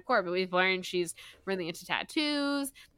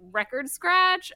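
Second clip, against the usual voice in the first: mock disbelief; 10 semitones higher, 4 dB louder, slower than usual. [name] stunned by rumor.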